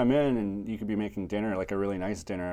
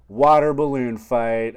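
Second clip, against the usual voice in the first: loud, drawn-out excuse; loud playful cover; 3 semitones higher, 10 dB louder, slower than usual. Retold or imitated. Dave covering his blunder, loud and playful. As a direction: shout it like a goofy save. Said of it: "he can be like".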